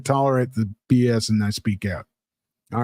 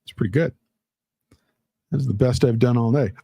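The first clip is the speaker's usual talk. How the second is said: usual speaking voice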